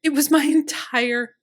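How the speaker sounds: loud, high, fast bitter lament; near-shouted self-pity; anguished outburst. A narrator's reading.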